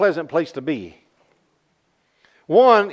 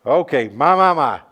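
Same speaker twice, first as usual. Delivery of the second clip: laughing; 6 semitones lower than usual. Real, not acted. Preacher laughs at his own joke.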